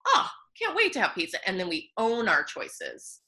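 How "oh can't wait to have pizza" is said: higher, eager "can't wait"; eager self-talk. Pretend. Imagines telling herself she owns her pizza choice, eager and higher. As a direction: sound delighted about pizza.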